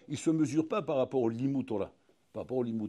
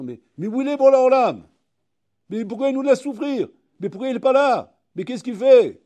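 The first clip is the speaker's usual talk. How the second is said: loud pleading cry, pitched much higher; anguished cry; 8 semitones higher, 12 dB louder than usual. Retold or imitated. People in suffering crying out to God, loud and anguished.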